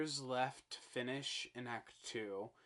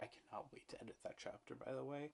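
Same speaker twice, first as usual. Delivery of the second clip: whispered aside; 10 dB quieter, faster than usual. Real, not acted. Aside about editing.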